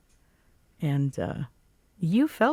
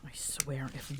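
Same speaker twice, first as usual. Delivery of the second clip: whispered; 15 dB quieter than usual. Acted. Grumbling to herself after arriving.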